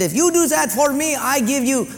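Mister Sato. Retold or imitated. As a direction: play the sushi owner in a mock Japanese accent. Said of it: "He said".

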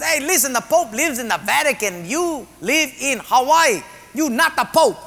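put-on high, sing-song Portuguese accent. Second patient arguing he lives in Hawaii, comic Portuguese accent. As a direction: argue it out in a bouncy Portuguese accent. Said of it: "Second Portuguese guy says".

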